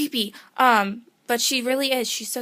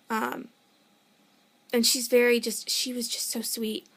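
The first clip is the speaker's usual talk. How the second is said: ordinary talk, nothing marked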